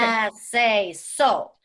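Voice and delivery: drawn-out goofy voice; weird voice. Shown as a demonstration. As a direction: stretch it out, weird and big. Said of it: "say it ... with your weird voice".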